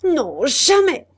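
slow, high, loud outcry; exclaim. Acted. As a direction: cry out your refusal. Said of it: "s'écria-t-il". (s'écrier lentement, voix haute et forte)

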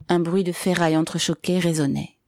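usual speaking voice

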